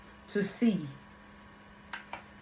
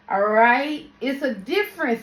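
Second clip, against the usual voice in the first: ordinary delivery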